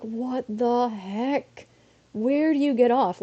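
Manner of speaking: incredulous, stretched-out complaint; retold indignation. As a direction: protest in exasperated disbelief.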